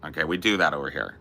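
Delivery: usual speaking voice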